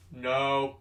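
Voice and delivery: a firm, flat mock coach no; stern coach no. Coach refusing to let fighter win, firm no. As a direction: shut it down like a grim coach. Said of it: "He was just".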